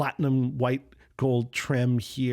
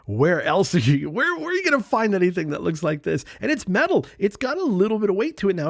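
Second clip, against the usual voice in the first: higher, louder, quick rush of amazement; excited marvel; 7 semitones higher, 5 dB louder, faster than usual. Real, not acted. Vlogger wowing viewers over a two-dollar pen, excited. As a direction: sell the bargain with real excitement.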